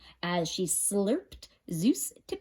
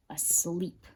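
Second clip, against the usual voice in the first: ordinary delivery, nothing marked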